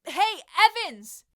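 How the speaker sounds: loud, high call to a retreating back; boy calls again after the girl who won't look back, loud and high. A narrator's reading. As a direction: throw the shout after her.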